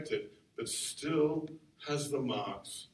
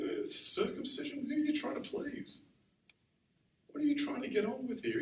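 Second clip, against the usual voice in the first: voicing Paul; 10 semitones higher than usual. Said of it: "He's saying".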